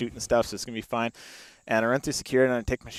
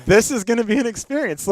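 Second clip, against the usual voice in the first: mock cheerful resignation; 9 semitones higher, 6 dB louder than usual. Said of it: "I was like".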